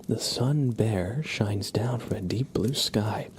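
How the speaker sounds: ordinary delivery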